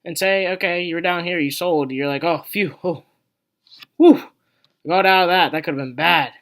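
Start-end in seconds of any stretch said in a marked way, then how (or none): 2.2-6.3 big relieved phew, then a louder, higher got out of that; relieved exhale. Retold or imitated. To viewers, playing a seller who dodged a drop, with a hammed-up relieved phew. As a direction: blow out a mock sigh of relief and half-cheer the escape. Investor relieved after selling.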